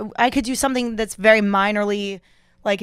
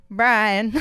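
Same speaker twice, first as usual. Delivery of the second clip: short drawn Southern American "Brian"; Southern accent. Retold or imitated. Greeting the producer Brian in a playful Southern accent. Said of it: "what's with the Southern accent?".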